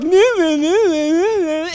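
high, crying no's fading out; mock wailing. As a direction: wail like a self-pitying Joshua. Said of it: "He's like".

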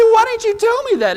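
very high, incredulous squeal; outraged disbelief. Retold or imitated. Learns Ralph's trick.

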